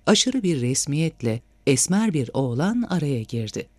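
usual speaking voice

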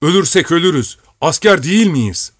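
commander's defiant outcry; rallying cry. A narrator's reading. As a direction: rouse the soldiers with a high call. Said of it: "diye haykırdı".

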